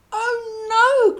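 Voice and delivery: high, loud "oh no" of dismay; dismayed cry. A narrator's reading. George finds the shop closed, high loud dismayed cry. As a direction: cry out as the shop door won't open. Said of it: "cried George".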